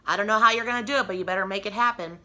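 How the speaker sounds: fast, loud, pushy boss voice; demanding boss. Retold or imitated. Boss pressuring her at work, fast and loud. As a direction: rattle it off like a boss laying down the law.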